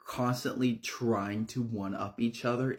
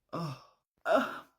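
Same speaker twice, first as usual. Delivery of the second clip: hammed-up dying groans; short mock groans of agony; theatrical groan; 7 semitones higher than usual. Brandy bleeding out on the floor, fake dying groans.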